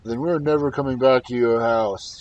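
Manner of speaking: loud troll shout from afar. Acted. Troll shouts back at Halvor from the forest. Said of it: "shouted the troll".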